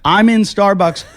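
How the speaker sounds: loud calling into an empty shop. Retold or imitated. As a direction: call out, is anyone there. Locked in, calling out.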